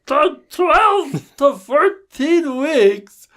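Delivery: very high, dopey, dragged-out customer voice; worst voice. Retold or imitated. Podcaster mocks a demanding client in his worst voice, very high and slow. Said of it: "let me put on my worst voice".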